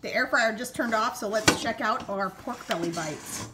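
usual speaking voice

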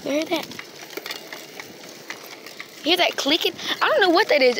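surprised. Real, not acted. Startled by strange clicking.

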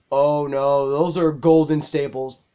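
usual speaking voice

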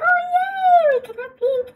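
happy rabbit. Acted. Reader voicing the rabbit cheering about pink things, high and thrilled. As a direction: squeal it like a delighted little bunny. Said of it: "She's like".

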